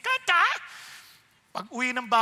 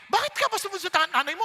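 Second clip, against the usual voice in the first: fast, higher scolding voice; mock scolding parent; 7 semitones higher, 7 dB louder, faster than usual. Parent scolding a child for not obeying, nagging.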